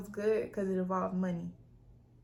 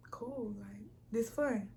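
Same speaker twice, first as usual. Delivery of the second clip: softly whispered to herself; 8 dB quieter, slower than usual. Real, not acted. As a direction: breathe it out, barely voiced. Recalling trip feeling.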